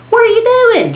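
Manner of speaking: high, loud, disbelieving shout from the car window; incredulous yell. Retold or imitated. Yelling at friend from car.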